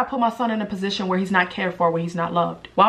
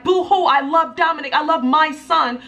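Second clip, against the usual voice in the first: mocking whine; 7 semitones higher, 3 dB louder than usual. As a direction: parody a fake tearful post. Mocking the ex's posts.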